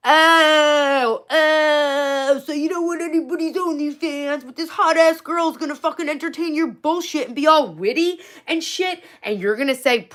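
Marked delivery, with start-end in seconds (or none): 0.1-9.0 high, loud, whiny mockery; hammed-up high-pitched griping; mocking rant. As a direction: wail it in exaggerated, sing-song complaint. Mocking rant at the match's reply.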